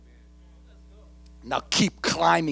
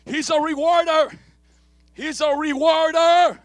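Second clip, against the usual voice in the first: high, loud preaching on rewarder; loud, high preacher climb; building preaching; 12 semitones higher, 5 dB louder than usual. Acted. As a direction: build the repetition.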